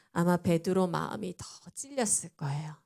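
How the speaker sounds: ordinary talk, nothing marked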